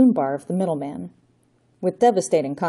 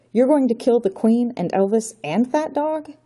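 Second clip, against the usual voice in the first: put-on aghast boy can't believe it; Johnny horrified at the plan; shocked disbelief; 6 semitones higher than usual. Johnny shocked by murder plot.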